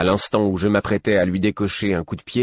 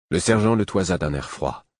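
plain reading voice

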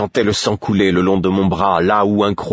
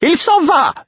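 very high, loud desperate cry; panicked high shout; fearful cry; 5 dB louder than usual. A narrator's reading. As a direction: cry out in fear and despair. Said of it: "de la peur et du désespoir dans sa voix".

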